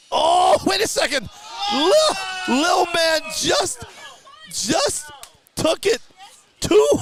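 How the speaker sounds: high, loud shouting of the upset win; excited shout. Real, not acted. Upset win at the drag race.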